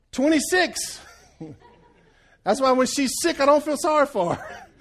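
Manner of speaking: high, loud, laughing through it. Real, not acted. Preacher laughs telling the congregation his empathy score. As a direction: crack up at his own score. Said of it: "laughs heard".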